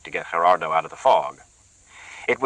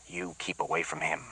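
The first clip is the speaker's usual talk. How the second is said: quiet warning; 13 dB quieter than usual. Acted. Sharkey quietly warns Bertha to keep away from Gerardo. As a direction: warn her off without raising the voice. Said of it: "Sharkey said quietly".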